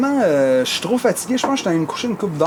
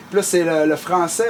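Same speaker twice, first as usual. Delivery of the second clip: normal talking voice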